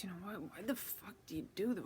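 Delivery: usual speaking voice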